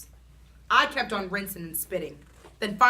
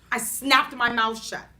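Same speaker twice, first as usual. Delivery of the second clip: usual speaking voice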